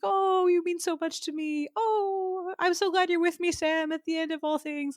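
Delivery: lightly voiced high, soft sappy farewell; mocking a sentimental deathbed farewell to Sam, soft and high; mock tender. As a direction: ham up a tearful, tender goodbye. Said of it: "I'm not one for being held tenderly".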